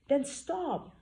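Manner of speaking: frustrated retort. Acted. Frustrated heroine snaps back.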